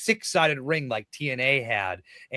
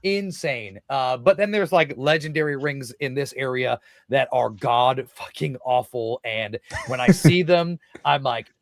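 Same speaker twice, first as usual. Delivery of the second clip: usual speaking voice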